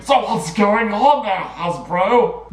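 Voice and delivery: loud, high-pitched, bewildered demand; hammed-up indignant challenge; mock outrage. Fan calling out Hasbro over the writing credits, mock outrage.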